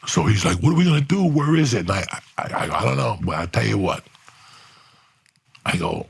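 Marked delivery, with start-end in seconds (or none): 0.6-1.8 high, louder fake alarm; mock panic. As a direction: play the liar pretending to panic. Quoting Steve acting alarmed.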